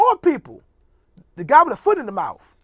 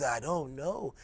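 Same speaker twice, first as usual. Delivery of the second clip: lower, softer, drowsy mumble; sleepy Ben Carson impression; 10 semitones lower, 12 dB quieter, much slower than usual. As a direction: sound half asleep and dazed. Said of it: "He talk like this".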